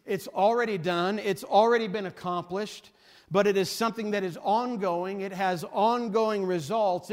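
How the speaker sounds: loud, rolling pulpit delivery; full-force preaching. Acted. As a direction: proclaim it to the whole congregation. Preaching the opening verse loudly.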